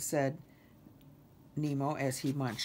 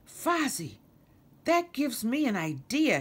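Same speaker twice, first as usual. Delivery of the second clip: higher, louder burst of inspiration; excited cry; 9 semitones higher, 6 dB louder than usual. A narrator's reading. Kermit suddenly has an idea, excited cry. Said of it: "he cried".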